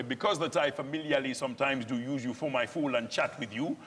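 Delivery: stage delivery of Shakespeare, Nigerian lilt; Shakespeare verse in Nigerian accent. Shown as a demonstration. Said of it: "we're foreigners speaking this".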